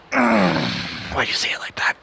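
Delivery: low, hushed aggrieved aside; dismayed whisper under the breath; muttered dismay. Retold or imitated. Passenger to the ex-wife who gave his name, muttered dismay.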